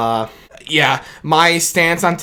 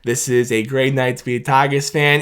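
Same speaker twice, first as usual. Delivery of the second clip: ordinary talk, nothing marked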